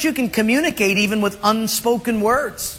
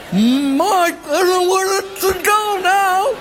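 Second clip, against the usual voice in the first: higher, slow drawn-out call; high put-on voice; 9 semitones higher, slower than usual. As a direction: stretch it out in a high voice. Voiced exchange, unclear.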